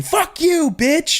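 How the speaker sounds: very high-pitched play-angry curse; mock angry outburst. Snapping back at someone's negative comment, mock angry. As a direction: curse back without managing to sound mean. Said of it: "you just get really angry".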